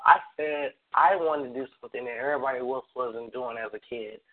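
normal talking voice

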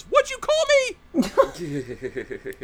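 high, loud mock-outraged yell; outraged retort. Imitating a streamer reacting to a zombie's insult, mock-outraged yell. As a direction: shriek it in hammed-up offence. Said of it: "he goes".

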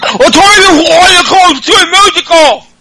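Becky Lynch ordering the music off, garbled high yell; mangled Becky Lynch impression. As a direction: mush the words into an angry high yell. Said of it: "I just can't do her voice".

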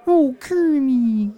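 stretched out Kermit the Frog voice; slow, high froggy drawl; lightly voiced Kermit; Kermit impression. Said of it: "Miss Piggy?".

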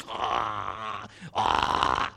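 snore like the TV husband; rasping, drawn-out pretend snores; imitated snoring. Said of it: "the husband was like".

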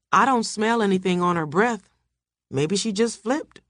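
ordinary delivery, nothing marked

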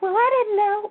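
high-pitched mock protest; put-on embarrassed, high-pitched defence; embarrassed excuse. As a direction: imitate someone caught out, voice shooting up. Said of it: "then they'll be embarrassed".

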